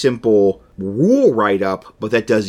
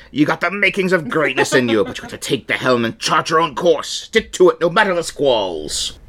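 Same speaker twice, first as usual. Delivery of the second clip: higher-pitched rousing pirate; 6 semitones higher than usual. Acted. Pirate mentor encouraging a young sailor, pirate voice. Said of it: "I'm gonna do a pirate voice".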